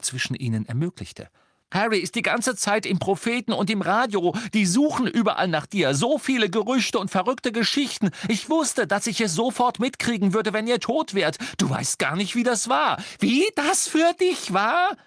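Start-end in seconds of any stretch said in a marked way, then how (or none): 1.7-13.0 high, heated outpouring; agitated, raised-pitch protest; bellowing over her. A narrator's reading. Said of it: "brüllte Ron und übertönte". 13.2-15.0 very high, slow outraged screech; piercing, stretched, indignant echo; shrill. A narrator's reading. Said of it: "Ihre Stimme war jetzt so schrill".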